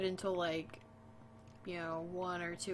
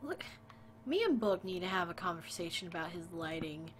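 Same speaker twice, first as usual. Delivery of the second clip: ordinary talk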